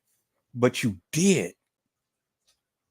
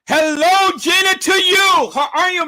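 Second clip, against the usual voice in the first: very high, loud shouted welcome; exuberant greeting; 8 dB louder than usual. Real, not acted. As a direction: shout a delighted hello to a guest. Calling out greeting to viewer.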